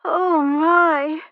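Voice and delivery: high, loud theatrical cry of fainting; a lady staging a swoon in the royal stand; feigned swoon. Acted. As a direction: fake a faint with a crying gasp. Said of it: "let her eyes flutter shut".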